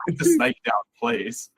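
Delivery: high-pitched mock exasperation; acted-out urgent demand, voice jumping high; imitated exasperated plea. Retold yelling over the snake.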